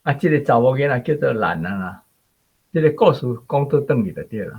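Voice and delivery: ordinary talk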